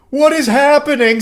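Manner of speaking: high, loud disbelieving cry; exasperated outburst. Real, not acted. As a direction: throw up your hands in shock. Reacting to his message.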